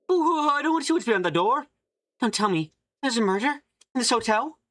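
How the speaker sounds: high, jittery scared voice; frightened character. Acted. Nervous guest fearing what lies behind the door, high and scared. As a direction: squeal it out like someone dreading the murder.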